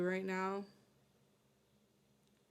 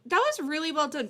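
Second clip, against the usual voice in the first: loud, higher, earnest praise; emphatic praise; 7 semitones higher, 6 dB louder than usual. Real, not acted. Streamer praising the guest's honesty, loud and heartfelt. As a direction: pour real enthusiasm into the compliment.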